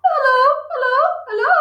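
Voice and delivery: high, loud parrot hello; parrot calling. Acted. Parrot in the chimney calling down to Matilda, high and loud. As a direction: squawk it down the chimney. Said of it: "a voice came loud and clear".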